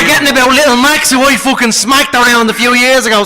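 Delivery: put-on cocky Irish accent, quick boast; Irish brogue, mocking a rival; Conor McGregor impression. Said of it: "the Connor one".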